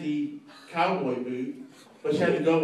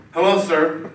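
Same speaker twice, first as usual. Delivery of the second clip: Chris Hansen; 9 dB louder, slower than usual. Retold or imitated. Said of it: "Chris Hansen comes out like".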